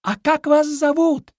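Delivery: loud, very high call; shouting. A narrator's reading. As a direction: call out after someone walking away. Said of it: "крикнул". (крик вдогонку, высоким голосом)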